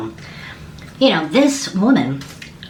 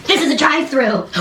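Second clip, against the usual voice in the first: amazed disbelief; 6 semitones higher than usual. Real, not acted. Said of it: "amazed repeat".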